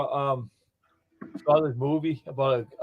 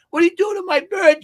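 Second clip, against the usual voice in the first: angry lady; 9 dB louder than usual. Retold or imitated.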